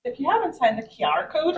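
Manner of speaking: mimicking a friend's Derry accent; lightly put-on Londonderry lilt. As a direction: say it like a broad Derry girl. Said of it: "she's very Londonderry accent".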